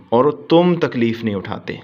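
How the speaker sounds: ordinary delivery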